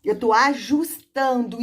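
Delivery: put-on slow and deliberate, as if to a child; teacher's slow classroom voice; slow demo speech. Shown as a demonstration. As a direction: spell it out slowly for the class. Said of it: "o que que tá acontecendo".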